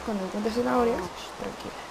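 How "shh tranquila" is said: whispered shh, soft and high; hushing whisper. Pretend. Gamer hushing a nervous animal, whispered. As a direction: hush the animal gently.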